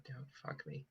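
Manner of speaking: whispered plea to the dice. Real, not acted. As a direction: breathe it under your breath. Praying over a dice roll.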